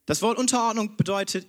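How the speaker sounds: ordinary talk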